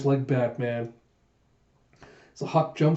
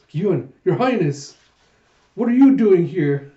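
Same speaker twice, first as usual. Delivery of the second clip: higher, louder, caught off guard; startled dismay; 5 semitones higher, 6 dB louder than usual. Acted. Huck caught by Yun and Her Highness, startled dismay. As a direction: blurt it in alarm at being caught.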